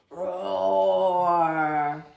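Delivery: big low roar; tiger roar. Acted. Tiger roars.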